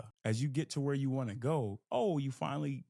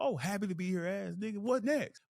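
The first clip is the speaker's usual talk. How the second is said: teasing teammates; 6 semitones higher than usual. Retold or imitated. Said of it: "I used to say that".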